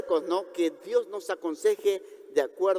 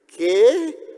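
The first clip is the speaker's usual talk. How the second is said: mock disbelief; 3 semitones higher, 7 dB louder than usual. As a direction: exclaim like a climber told to let go. Climber balks at God's command.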